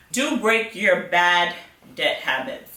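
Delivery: normal talking voice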